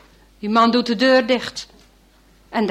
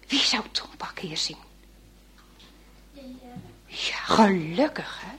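whisper; 7 dB quieter than usual. A narrator's reading. Narrator whispers aside to children.

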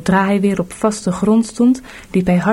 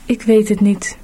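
ordinary delivery, nothing marked

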